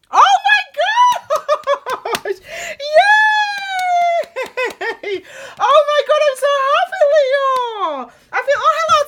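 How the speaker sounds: very high, very loud squeals of joy; thrilled squealing at a great card; real excited shriek. Real, not acted. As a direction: shriek with real delight, almost crying. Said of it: "I got a bit excited then".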